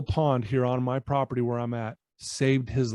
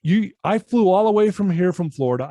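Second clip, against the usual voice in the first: indignant complaint; 4 semitones higher, 7 dB louder than usual. Retold or imitated. Angry client retold outburst.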